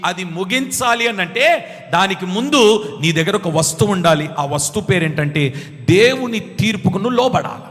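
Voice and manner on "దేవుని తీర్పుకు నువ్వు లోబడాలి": ordinary delivery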